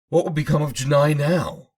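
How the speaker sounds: high, loud, worried cry; alarmed question. Acted. Prisoner asks Ormo about Janai in alarm. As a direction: blurt it out in alarm. Hearing of the queen's death.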